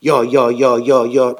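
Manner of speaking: low, steady repeated yes; wagon wheel voice. Retold or imitated. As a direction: chant it like a turning wheel. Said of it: "עס הערט זיך אזוי".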